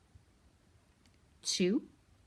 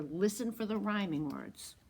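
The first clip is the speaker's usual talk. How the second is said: usual speaking voice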